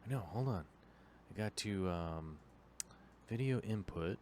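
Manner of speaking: hushed, low voice; keeping quiet. Real, not acted. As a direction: murmur softly near a sleeping dog. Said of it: "try to not wake the dog".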